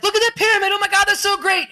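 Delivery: high-pitched gushing tourist; mock excitement. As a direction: gush like an awestruck tourist. Acting out an awestruck tourist.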